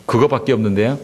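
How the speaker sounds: ordinary delivery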